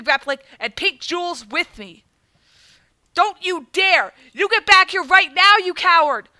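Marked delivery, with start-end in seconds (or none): 3.1-6.3 loud, higher furious yell; angry yell. Real, not acted. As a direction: scream after a fleeing coward. Villain escaping, player yells.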